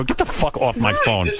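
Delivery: retelling the line through laughter; laughing it out. Retold or imitated. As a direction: blurt it while cracking up. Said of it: "I just started to laugh".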